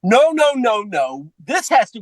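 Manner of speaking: imitating a woman putting her foot down; insistent objection. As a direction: cut them off with alarmed no's. Lauren insisting, cutting the others off.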